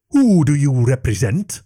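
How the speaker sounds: loud, commanding dragon demand; stern dragon. A narrator's reading. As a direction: bark it like an irritated dragon. Dragon questions intruders.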